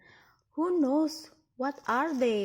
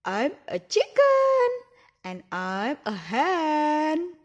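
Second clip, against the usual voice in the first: chicken voice; 3 semitones higher, 9 dB louder than usual. Pretend. Speaking as the chicken toy.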